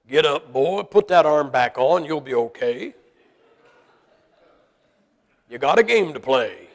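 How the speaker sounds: tough dad. Retold or imitated. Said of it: "Pop says".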